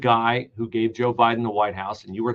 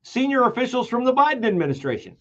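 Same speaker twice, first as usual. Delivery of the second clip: ordinary delivery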